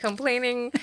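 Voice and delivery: animated, chuckling emphasis; amused listing. Real, not acted. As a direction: pile up each item with amusement. Amused listing of mother's complaints.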